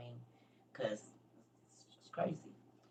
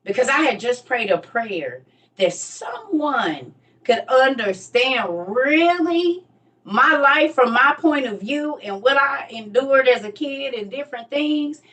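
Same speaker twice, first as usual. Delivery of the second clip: usual speaking voice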